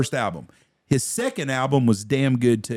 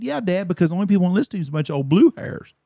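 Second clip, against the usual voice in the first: mock sass; 6 semitones higher, much faster than usual. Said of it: "I said".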